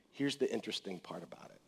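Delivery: breathy whisper, leaning in; hushed aside before the key verse. Real, not acted. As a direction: confide it to the congregation.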